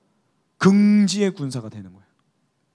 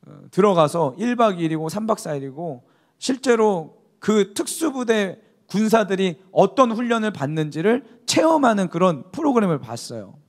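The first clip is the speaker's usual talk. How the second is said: ordinary delivery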